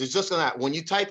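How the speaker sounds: usual speaking voice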